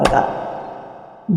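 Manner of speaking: 'Prakash' breathed out slow and almost silent; 'Prakash' drawn out as a hushed, fading whisper; whispered fade. Shown as a demonstration. Repeated word fading to whisper.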